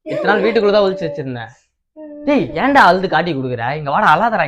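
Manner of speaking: ordinary talk, nothing marked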